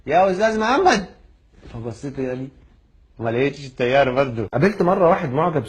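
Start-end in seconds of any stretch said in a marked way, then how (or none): 0.1-1.0 high-pitched mock call; imitated caller stretching up high. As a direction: hail someone from a distance, retold. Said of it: "بينادي عليا".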